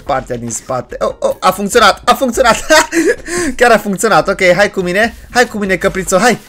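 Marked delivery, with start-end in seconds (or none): 2.7-3.5 short, high burst of laughter. Real, not acted. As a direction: laugh in delight at it working. Delighted that the lick worked. (râs scurt, încântat)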